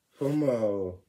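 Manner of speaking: usual speaking voice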